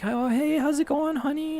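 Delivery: high, soft coaxing voice to a scared dog; sweet baby talk. Retold or imitated. Owner sweet-talking a shy new dog, high and soft. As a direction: coo gently to win her over. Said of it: "talk to her really nicely".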